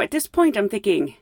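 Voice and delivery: usual speaking voice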